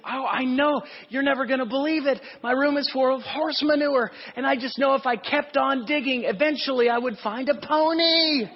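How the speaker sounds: high giddy excitement over the manure; bubbling over. Retold or imitated. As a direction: burst out with joy like a kid who found treasure. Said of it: "He was just bubbling over".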